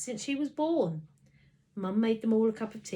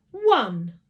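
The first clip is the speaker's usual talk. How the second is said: admission; 2 semitones higher, 13 dB louder than usual. A narrator's reading. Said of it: "I admitted".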